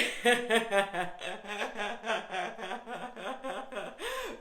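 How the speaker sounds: long hearty falling laugh; laughing on purpose. Shown as a demonstration. A laughter yoga teacher leading the group, hearty laugh. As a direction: laugh all the way down, full out. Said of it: "laugh on the way down".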